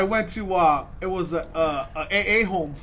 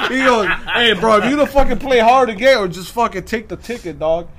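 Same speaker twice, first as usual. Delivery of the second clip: loud, half-joking shout of the cop; belting out the cop's threat, laughing; mock yell; 4 semitones higher, 9 dB louder than usual. Retelling the cop yelling at him to take the ticket, loud mock shout.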